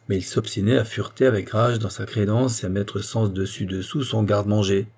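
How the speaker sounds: plain reading voice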